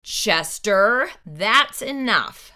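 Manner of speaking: usual speaking voice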